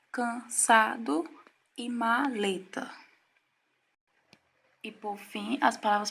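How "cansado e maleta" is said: drawn out, syllable by syllable; slow example words. Shown as a demonstration. Teacher slowly reading example words to students. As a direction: read each word slowly so the syllables show. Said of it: "pronunciar estas palavras vagarosamente".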